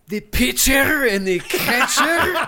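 high, slow hammed-up German accent with a laugh; mock German accent. Mocking Americans at the ball game, hammy German accent. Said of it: "why a French accent, though?".

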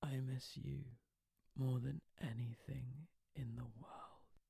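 whispered, slow and husky, trying to sound sexy. Acted. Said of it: "putting on a husky voice to try and sound more sexy".

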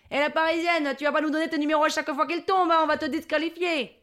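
high, insistent Marseillais telling-off; scolding southern local. Retold or imitated. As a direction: warn the Parisian in a southern voice. Locals scold her before the hall. (Marseillais qui engueule la Parisienne)

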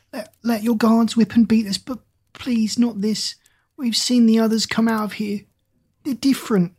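high, frightened begging; pleading. Acted. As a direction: plead desperately for mercy. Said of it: "began to whimper".

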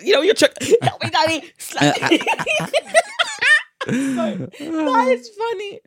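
high, loud laughing fit. Real, not acted. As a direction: squeal with laughter. Laughing, mock child pleas.

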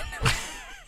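can't hold it in; high squeal of laughter. Real, not acted. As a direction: giggle breaking through. Prank call breaking into laughter.

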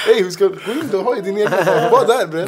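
high, loud, laughing comeback; teasing street-slang retort; mock exasperated retort. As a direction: throw it back at them half-laughing. Quoting own retort to friends.

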